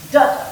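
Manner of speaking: first "Da" strong, second "da" weak; "Da, da" as long then short; trochee rhythm. Shown as a demonstration. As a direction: hit the first "Da", drop the second "da". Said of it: "one strong, one weak".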